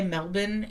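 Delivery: Melbourne said as MEL-bun, without the r; Australian-style pronunciation. Shown as a demonstration. Feeling like a fake Australian, Australian-style pronunciation. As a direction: say Melbourne with a swallowed "bun" ending. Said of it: "I'm trying to be Australian, but I'm not".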